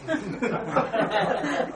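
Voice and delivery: quiet high breathy chuckle; soft laugh. Real, not acted. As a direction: laugh under your breath. Laughs after Barry got fired.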